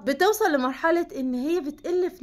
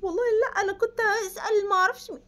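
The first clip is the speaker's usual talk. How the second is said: high, pleading mock excuse; defensive justifying; 9 semitones higher than usual. Wife over-justifying herself to a suspicious husband, high mock pleading. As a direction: whine out an over-eager excuse. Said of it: "ما تقعديش".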